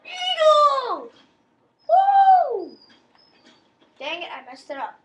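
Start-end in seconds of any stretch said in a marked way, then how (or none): 0.1-2.8 high loud Eagle whoop; excited yell. Acted. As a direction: shout it out with a cheer. Calls trick, cheers.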